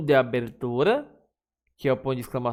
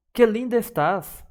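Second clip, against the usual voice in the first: higher and louder, full of admiration; admiring exclamation; 8 semitones higher, 4 dB louder than usual. Shown as a demonstration. Teacher to class, showing exclamation of admiration, delighted. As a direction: gush over how lovely she looks. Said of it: "Exemplo de admiração".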